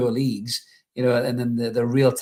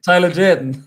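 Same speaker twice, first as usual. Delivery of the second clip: Tyler Durden called out higher and louder; gleeful, louder, higher repeat of Tyler Durden; delighted exclaim; 5 semitones higher, 7 dB louder than usual. Real, not acted. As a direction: land the name like a punchline. Triumphant repeat of the name.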